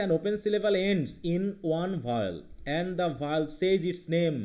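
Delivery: slow rule reading. Shown as a demonstration. Teacher rereads the rule.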